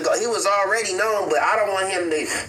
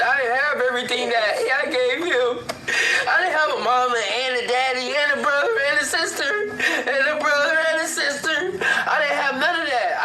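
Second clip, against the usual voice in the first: crying through the words, pitch climbing higher; breaking into tears; 8 semitones higher than usual. Real, not acted. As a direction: voice rising high as he starts to cry, real tears. Grieving father breaks down recalling childhood.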